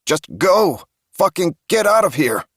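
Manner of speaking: high, loud yell to drive her off; yelling at Melanie to leave; furious yell. Acted. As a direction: scream at her to get out.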